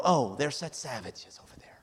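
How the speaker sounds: whispered, smug brush-off; a hushed, mocking shrug of a line; mock dismissive whisper. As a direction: sneer it under your breath as if mocking them. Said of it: "we just kinda go".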